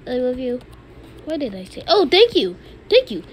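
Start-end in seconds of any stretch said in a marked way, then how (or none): 1.9-3.2 excited thanks. Real, not acted. Mock thanks to opponent.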